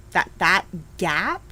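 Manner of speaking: normal talking voice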